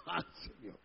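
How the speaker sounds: ordinary delivery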